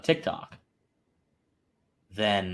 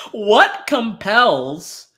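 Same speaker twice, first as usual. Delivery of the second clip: laughing; 9 semitones higher, 9 dB louder, much slower than usual. Real, not acted. Laughing, can't finish question.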